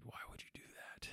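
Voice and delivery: whispered, baffled aside; hushed disbelief. Retold or imitated. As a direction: drop to a near-silent whisper, as if asking in your head. Retelling his baffled reaction to son.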